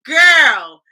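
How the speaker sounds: loud high exclamation. Real, not acted. Host exclaiming to a viewer, loud and high.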